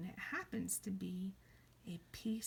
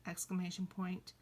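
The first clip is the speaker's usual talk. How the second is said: ordinary talk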